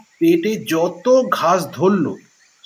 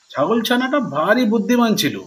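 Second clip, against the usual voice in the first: ordinary delivery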